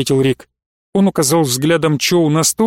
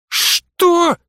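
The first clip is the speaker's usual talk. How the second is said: shocked high yelp of outrage; flaring up; 13 semitones higher than usual. A narrator's reading. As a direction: shoot the word up high in disbelief. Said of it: "взвился Прыгунов".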